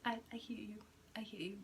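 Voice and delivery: soft unvoiced whisper, awed; whispered reply. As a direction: breathe it back to your intuition in a whisper. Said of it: "I was like".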